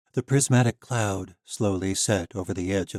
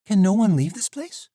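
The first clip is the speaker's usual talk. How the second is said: put-on young heroine voice; 12 semitones higher, 8 dB louder than usual. Misha asking about the valley.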